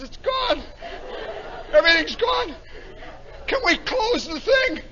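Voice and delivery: high, cracking mock wail; panicked crewman. Johnson pleading to the lieutenant to close up, panicked wail.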